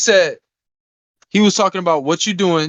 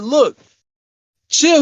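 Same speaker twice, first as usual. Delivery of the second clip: pitched-up, louder retold plea; half-hearted shout of chill; calling for chill; 9 semitones higher, 4 dB louder than usual. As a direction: tell him to chill, rising into a mock yell. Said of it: "I said".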